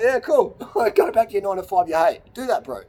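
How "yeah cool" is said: high, light dismissive reply; breezy brush-off. Retold or imitated. Answering critics with a high, breezy shrug. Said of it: "And I'm like".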